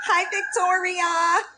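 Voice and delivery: high, drawn-out hello; exaggerated hello to a watching rival; mock-sweet greeting.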